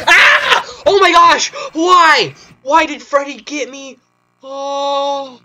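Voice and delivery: real high startled screams; yelping in fright at a jump scare; scared horror game streamer. Real, not acted. Jump scare while testing game.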